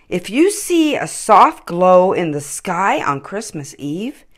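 ordinary delivery

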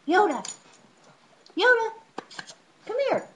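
high, loud repeated calls to a wandering pug; calling the dog. Real, not acted. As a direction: call him back across the room.

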